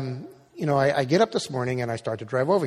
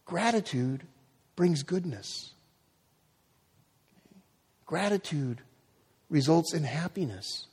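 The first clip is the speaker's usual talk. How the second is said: softer, slower closing appeal; quiet exhortation; 2 semitones higher, 4 dB quieter than usual. Acted. Pastor closing the sermon, softly sincere. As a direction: draw them in gently.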